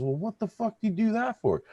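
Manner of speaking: outraged guy. Acted. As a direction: pitch it up in disbelief.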